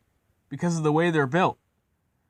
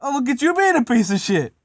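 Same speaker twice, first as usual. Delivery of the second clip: high, loud mocking crow; loud jeer; 10 semitones higher, 5 dB louder than usual. Gordon mocking embarrassed James, high loud jeer. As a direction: taunt in a raised, gloating voice.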